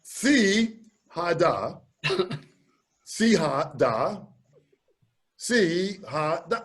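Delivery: si ha da muttered slowly to himself; drilling si ha da under his breath, slow; slow practice. Retold or imitated. Practicing the phrase alone before going on stage, slow. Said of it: "when I was practicing".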